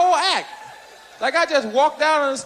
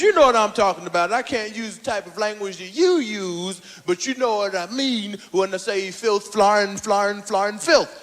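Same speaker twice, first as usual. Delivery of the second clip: imitating Cosby; 8 semitones lower than usual. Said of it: "He says".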